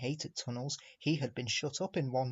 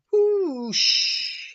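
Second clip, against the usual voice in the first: squirt noise; 10 dB louder than usual. A narrator's reading. Elephant squirting water.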